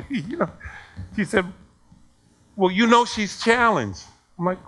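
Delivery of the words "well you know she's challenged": retold angry protest. Acted complaint of angry parent.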